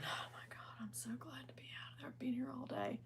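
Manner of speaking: hushed retelling of someone's relief; very soft, breath-only quote; imitated whisper. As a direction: whisper it as an overheard aside. Retells departing lady's relieved words.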